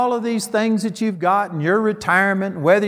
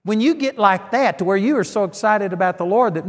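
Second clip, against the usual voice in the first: ordinary talk, nothing marked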